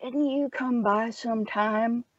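put-on quiet, distant old clerk voice; a hint of a doddering old man; lightly voiced old man. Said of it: "and he says".

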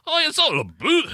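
high, hammed-up comic Scottish-Canadian voice; Mike Myers. Host clowns about "a boot", Mike Myers impression. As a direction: push it way up into a silly put-on voice. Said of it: "Just doing, like, Mike Myers".

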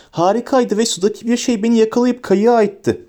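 put-on high-pitched excited boy; high, thrilled little voice; shrill voice. A narrator's reading. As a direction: gush it in a high piping voice. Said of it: "dedi tiz bir sesle".

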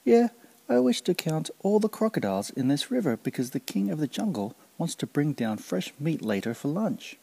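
high, eager and brave despite the fear; spirited reply. Acted. Kancil bluffing the crocodile about the king's lunch, high and spirited. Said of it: "spiritedly replied".

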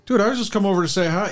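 high, whiny protest; high, wounded whine; mock hurt reply. Host voicing his own hurt reply, high.

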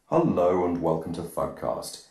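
usual speaking voice

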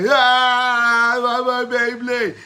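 loud, high, sustained mock wail; drawn-out toddler cry, hammed up; wailing young son. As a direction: imitate a small child bawling for a toy. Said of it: "my, my young".

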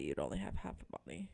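rushed unvoiced whisper. Real, not acted. Whispered punchline aside.

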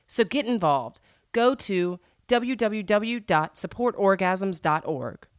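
announcer voice for a public service spot; old radio announcer. Acted. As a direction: read it like a mock PSA broadcast.

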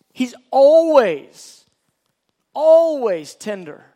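loud, slow, drawn-out emphasis; emphatic. Real, not acted. Preacher insists to the congregation that God is tender, loudly. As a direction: drive home always like a pulpit cry.

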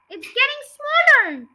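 put-on high, loud, excited boy; amazed exclamation. A narrator's reading. George sees the hen shrink.

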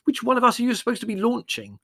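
indignant, loud, high-pitched protest; remonstrating. A narrator's reading. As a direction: complain at the culprit, pitch way up. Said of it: "he remonstrated".

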